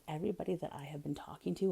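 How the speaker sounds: normal talking voice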